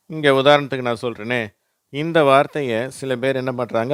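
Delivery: ordinary talk, nothing marked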